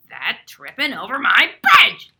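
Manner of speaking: troll roar. Acted. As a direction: roar it out like an angry troll under the bridge. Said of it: "roared the troll".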